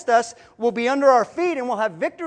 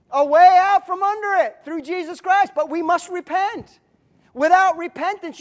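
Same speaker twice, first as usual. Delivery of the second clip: raised, emphatic call to repentance; impassioned preaching; 4 semitones higher, 4 dB louder than usual. Real, not acted. Preacher calling the congregation to repent, raised and impassioned. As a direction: lift it high and urge the congregation.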